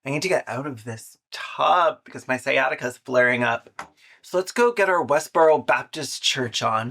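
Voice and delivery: ordinary talk, nothing marked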